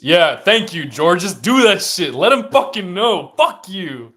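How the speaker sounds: high, very loud fan shouting; worked-up yelling. Real, not acted. Fan cheering George on and cursing the rival, worked-up yelling. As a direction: yell it high and hard at the game.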